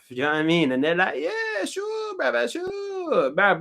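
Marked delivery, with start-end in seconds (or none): 1.2-3.6 mocking reply.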